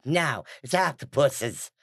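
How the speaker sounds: snooty correction. Retold or imitated. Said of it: "one person popped up and was like".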